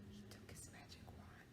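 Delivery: breathless suspense whisper. Acted. Teacher whispering before the trick. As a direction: build it up in a whisper.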